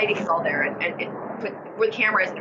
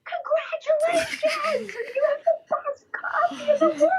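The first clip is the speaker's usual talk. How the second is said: much higher, slow, laughing shout of wonder; Will Ferrell as Buddy the Elf; slower than usual. Retold or imitated. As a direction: cheer like a wide-eyed elf. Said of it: "We're like".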